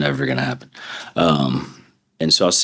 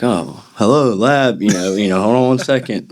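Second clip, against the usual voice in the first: mock receptionist voice; 6 semitones higher, 3 dB louder than usual. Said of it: "And I'd be like".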